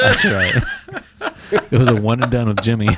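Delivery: laughing, high and fast. Real, not acted. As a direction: giggle through the line. Laughing answer, laugh heard.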